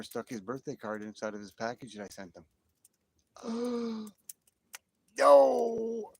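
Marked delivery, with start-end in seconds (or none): 3.3-4.3 high gasp of sudden realization; shocked gasp. Real, not acted. Realizing he forgot a friend's card, shocked gasp. 5.2-6.1 loud, high cry of disbelief; dismayed cry. Real, not acted. Realizing he forgot a friend's birthday card, dismayed cry.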